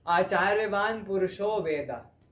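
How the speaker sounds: loud, slow Sanskrit verse; scripture recitation. Acted. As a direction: recite the verse slowly and forcefully. Recites Upanishad verse aloud.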